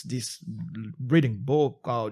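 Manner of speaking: ordinary talk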